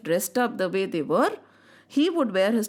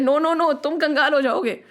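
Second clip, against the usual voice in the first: acted-out protest, higher and louder; urgent objection; 6 semitones higher, 4 dB louder than usual. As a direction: cut in to stop him, retelling it. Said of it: "When she stopped him".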